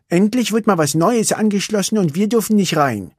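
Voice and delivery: put-on high, lively girl complaining; Vanellope's voice. Vanellope complains to Ralf, disappointed. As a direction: grumble about missing out.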